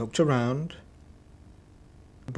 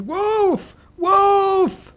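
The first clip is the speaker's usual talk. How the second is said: shouted wolf; 9 dB louder than usual. A narrator's reading. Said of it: "stood on the hill and shouted".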